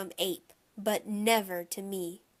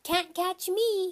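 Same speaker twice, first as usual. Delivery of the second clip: put-on high, slow taunting child; toddler hollering; 10 semitones higher, 4 dB louder, slower than usual. A narrator's reading. Toddler running off from the babysitter, high taunting holler. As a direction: tease in a little kid sing-song. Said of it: "he hollered".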